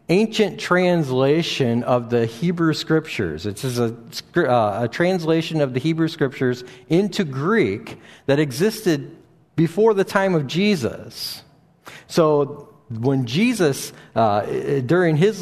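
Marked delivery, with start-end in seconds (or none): none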